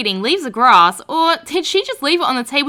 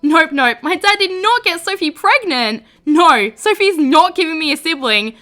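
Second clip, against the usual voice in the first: louder, much higher frantic denial; panicked disbelief; 5 semitones higher, 5 dB louder than usual. Acted. Daughter refusing to believe her dad's news, panicking. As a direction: spiral into panic and bolt.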